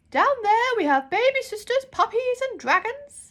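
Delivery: a grand, half-deaf librarian calling out. Acted. Deaf librarian shouts while holding her ear trumpet.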